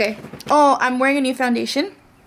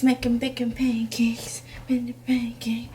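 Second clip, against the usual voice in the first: whispered; 5 dB quieter than usual. Pretend. Singsong pancake chant.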